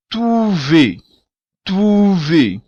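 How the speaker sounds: trouver with "oo" and stress on the last syllable; trouver, final syllable stressed; Creole word. Shown as a demonstration. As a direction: say trouver as "troo-VEH". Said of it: "o W também tem som de U".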